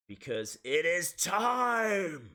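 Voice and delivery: slow, drawn-out announcer call; a hint of Bruce Buffer. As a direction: stretch it like a ring announcer. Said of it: "as Bruce Buffer from the U F C would say".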